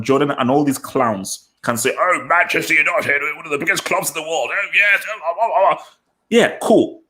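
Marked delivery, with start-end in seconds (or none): 2.0-5.8 airy upper-class English voice, fast and high; mocking posh British accent, a touch higher. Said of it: "Yeah, that was good accent". 6.3-7.0 a high plummy "yeah, cool"; high mock posh British aside; posh English accent. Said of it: "let's respect that accent".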